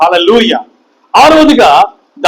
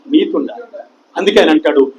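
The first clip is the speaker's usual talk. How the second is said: ordinary talk, nothing marked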